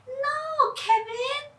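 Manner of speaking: put-on high, small girl's voice pleading; a worried sister saying no; Charlene's voice. Sister warns her brother, high and worried. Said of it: "Charlene said".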